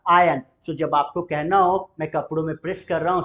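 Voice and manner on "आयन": आयन said louder, r dropped; iron without r. Shown as a demonstration. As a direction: stress आयन, no r. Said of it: "आयरन नहीं बोलेंगे". (ज़ोर से आयन, बिना र के)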